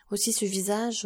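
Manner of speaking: usual speaking voice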